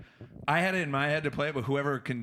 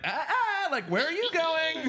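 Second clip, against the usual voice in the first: very high, drawn-out troll challenge; bridge troll; 4 dB louder, slower than usual. Acted. Troll popping out at a walking couple, high screechy challenge. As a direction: squawk it like a silly troll. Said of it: "this guy pops out and he's like".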